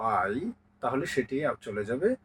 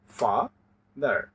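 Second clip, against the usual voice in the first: drawn out syllables; 4 semitones lower, much slower than usual. Shown as a demonstration. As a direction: stretch father very slowly, syllable by syllable. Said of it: "দুটি সিলেবলেই vowel আছে". (Father ধীরে ধীরে ভেঙে বলা)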